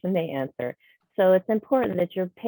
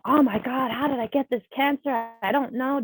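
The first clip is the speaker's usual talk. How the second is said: high-pitched upset outburst; distressed, overwhelmed patient; completely stressed; 7 semitones higher than usual. Acted. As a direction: act out reeling from bad news, voice raised in pitch. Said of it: "they're completely stressed".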